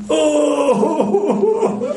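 high, drawn-out fake sob; hammed-up tearful wail; mock crying wail. Mimics wife's tearful plea.

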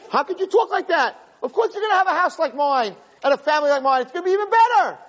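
high-pitched hammed-up protest; mock-appalled mother, rising to shrieky insistence. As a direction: gasp in horror, then scold and reassure at full pitch. Acted mother protesting girl's despair.

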